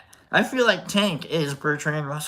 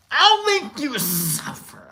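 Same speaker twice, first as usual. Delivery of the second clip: higher, slow menacing line; mock villain threat; 8 semitones higher, slower than usual. Vlogger hams up a threat over pizza, slow mock menace.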